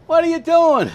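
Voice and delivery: high, loud retold yell; a big brother's exasperated shout. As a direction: yell it at a kid fumbling with a hammer. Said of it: "My brother was yelling".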